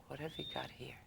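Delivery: whispered, sizing her up; hushed mock suspicion; sceptical aside. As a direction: breathe it like a wary kid eyeing a stranger. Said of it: "he looked at me like".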